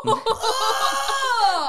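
high, loud disgusted shriek; real shock, laughing through it; shocked gasp and shout. Real, not acted. Hears friend swallowed vomit.

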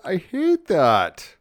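high, slow whiny complaint; mock whine. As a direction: pout it out like a hurt kid. Whining over bar blown up.

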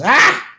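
short high-pitched cracking yell; sudden mock yell. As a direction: cry out as if you just snapped. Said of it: "And he yells".

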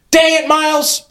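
high-pitched exasperated outburst; frustrated cry, pitched well up. A narrator's reading. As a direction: snap in frustration at your brother. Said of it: "I grunted in frustration".

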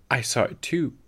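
plain reading voice

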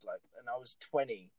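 usual speaking voice

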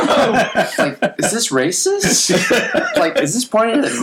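high-pitched puzzled reaction through laughter; mock bewilderment. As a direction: act out baffled disbelief, high and amused. Acts out his baffled reaction.

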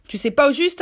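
high, loud mocking repeat of "Tu sais pas au juste"; mocking echo. Silver mocking Morgan's words, high and loud. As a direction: throw "Tu sais pas au juste" back at him scornfully.

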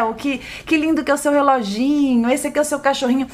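put-on high, sing-song praise to a child; affectionate parent. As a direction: admire a child's things with loving delight. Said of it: "Quando você traz com afeto".

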